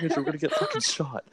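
high, laughing warning; laughing panic. Retold or imitated. Warning Andrew about getting shot, laughing panic. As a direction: laugh through the fear.

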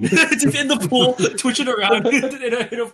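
high-pitched words through real giggles; laughing. Real, not acted. Friend picturing someone twitching in the pool, laughing. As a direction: crack up while trying to finish the joke.

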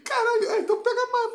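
loud, high exasperated yelling; exasperated outburst. Retold or imitated. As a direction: blow up in frustration. Retelling angry outburst over fries.

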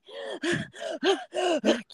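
winded gasp for air; out-of-breath gasp. Retold or imitated. Runner gasping halfway up the hill. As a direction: gasp like you can't breathe. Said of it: "Can't breathe".

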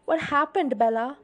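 loud, high urgent question; shout through door. A narrator's reading. Charlie pounds on Bella's door, shouting. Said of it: "Charlie shouted through the door".

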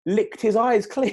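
laughing through the words; can barely get it out for laughing. Real, not acted. Laughing while telling punchline.

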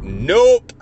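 loud, high-pitched retold yell; yelled refusal. Man refusing his coworker's advances in her bedroom, yelled out. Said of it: "I immediately yell out".

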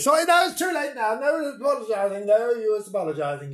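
high, haughty mock offence; high mock-offended scolding; mock indignation. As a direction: play the wounded diva.

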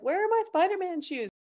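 put-on high-pitched little kid asking; lightly put-on, high child's voice; four-year-old child. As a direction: imitate a young child hunting for shoes. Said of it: "four-year-old is, like, trying to decide".

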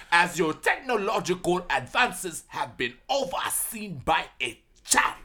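put-on slow, grand Wakandan accent; Wakandan God voice. God lamenting a child's oversight, slow Wakandan accent. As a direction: intone each word slowly with a Wakandan accent. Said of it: "Yeah, that's my God".

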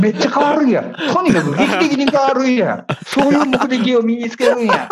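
playful Kansai dialect paraphrase; high, laughing Kansai dialect. Pretend. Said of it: "関西弁で言うとそうなんですね".

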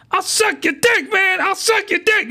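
very high desperate plea; hammed-up high begging; mock desperate girl. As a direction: beg in a squeaky voice. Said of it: "is what she says".

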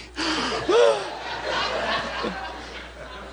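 high, drawn-out mock gasping; exhausted gasp. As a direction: gasp as if spent from the climb. Retells exhausted wife on climb.

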